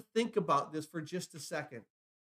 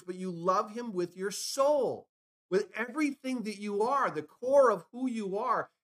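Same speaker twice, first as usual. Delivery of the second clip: higher-pitched, impassioned sermon delivery; emphatic preaching; 6 semitones higher, 3 dB louder than usual. Real, not acted. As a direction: preach it fervently, pitch lifted, driving the point home. Preacher drives home his main exhortation.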